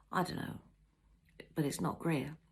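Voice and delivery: talking through a mouthful; mouth full. A narrator's reading. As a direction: say it round a bite of pasty. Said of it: "With a full mouth".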